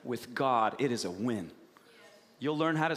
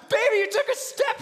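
loud, very high-pitched cheer; overjoyed parent; 6 dB louder than usual. Retold or imitated. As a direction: burst out delighted for the baby. Parent cheering toddler's first step.

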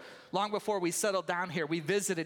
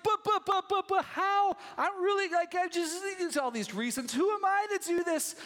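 high, frantic, stammering protest; a panicked Moses with excuses; 10 semitones higher, 3 dB louder than usual. Acted. Moses stammering excuses to God at the bush, high and frantic. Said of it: "And he's like".

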